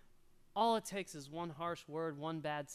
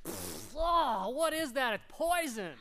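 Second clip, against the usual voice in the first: son spitting out salt water; 6 semitones higher, 8 dB louder, slower than usual. Retold or imitated. Pastor playing his son who spits out salt water, loud and high. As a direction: sputter then loud, high disgusted cry. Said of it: "he's like".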